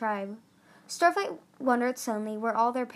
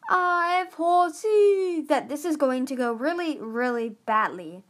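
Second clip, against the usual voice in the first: high, loud yell over the wind; 5 semitones higher, 6 dB louder than usual. Acted. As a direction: shout a gloomy prophecy into the gale. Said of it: "Fatespeaker yelled over the wind".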